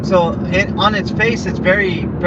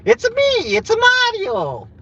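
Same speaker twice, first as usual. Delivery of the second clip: very high, squeaky Italian plumber voice; Mario. Pretend. Showing the chat a high Mario voice. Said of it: "There's my Mario impression".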